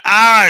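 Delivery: loud, high "ah" as if biting; short mock savage cry; imitated chomping yell. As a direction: mimic lunging in for a bite. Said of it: "picked it up and went".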